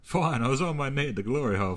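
usual speaking voice

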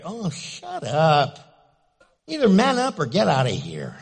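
dismissive, loud brush-off; mock tough-guy retort; scornful retort. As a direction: shut them down with scorn. Mocking reply to anonymous texts.

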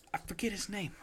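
breathless whispered mutter; whispered aside. Real, not acted. Co-host trailing off about an actor's name, whispered. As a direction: whisper it half to yourself. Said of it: "unvoiced aside".

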